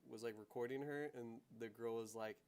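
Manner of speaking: ordinary talk, nothing marked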